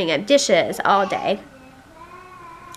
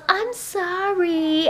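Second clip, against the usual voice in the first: put-on higher, slow, drawn-out child voice; imitated child's apology; 6 semitones higher, much slower than usual. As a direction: stretch out a little girl's sing-song sorry. Mom imitating daughter's drawn-out apology.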